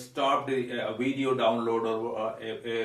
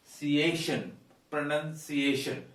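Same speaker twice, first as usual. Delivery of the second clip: 'Pronunciation' stressed on the 'a' of 'ciation'; slower than usual. Shown as a demonstration. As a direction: lean on 'see-AY-shun' at the end of 'Pronunciation'. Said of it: "the stress goes here".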